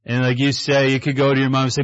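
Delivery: ordinary talk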